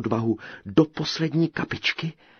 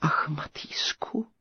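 high, slow, breathy sigh; 7 semitones higher, 4 dB quieter, slower than usual. A narrator's reading. Princess longs for the tailor, soft high sigh. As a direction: sigh the name softly. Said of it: "vzdychla knížecí dcera".

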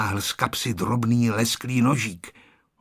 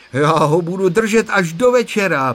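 loud, higher-pitched vow; emphatic, raised voice full of resolve; proud declaration; 6 semitones higher, 6 dB louder than usual. A narrator's reading. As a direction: declare it forcefully. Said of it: "prohlásil Honzík a na tváři mu bylo vidět, jakou má sílu".

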